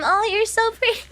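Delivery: bright high compliment, put-on cheer; forced cheer. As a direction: sound cheerful through the nerves. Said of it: "trying to be cheerful".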